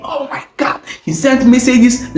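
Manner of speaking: plain reading voice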